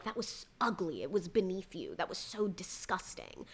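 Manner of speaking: prissy, hushed imitation of the women; mocking prim disapproval. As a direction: parody the women's hushed tut-tutting. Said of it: "all of the women chime in to be like".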